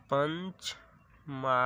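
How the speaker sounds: "Panchama" drawn out slowly, syllable by syllable; slow, spelled-out demo. Shown as a demonstration. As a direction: stretch "Panchama" out very slowly for the class. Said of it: "पंचमा अक्षर कहलाता है।". ("पंचमा" को बहुत धीरे, खींचकर बोलें)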